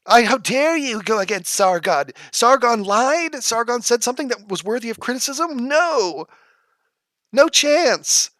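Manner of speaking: squeaky offended fanboy voice, slow on "Sargon lied"; mock outraged fan. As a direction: ham up an indignant Sargon defender. Parodying outraged Sargon fans.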